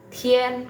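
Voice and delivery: "tien" slowly as t-hyen; "tien" with h after t and e vowel; correct Mandarin reading. Shown as a demonstration. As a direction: draw out "tien" with an aspirated t. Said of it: "T-nya itu dibaca jadi T H".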